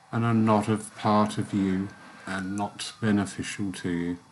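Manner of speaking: calm closing of a guided meditation. Acted. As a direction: ease them softly back to waking.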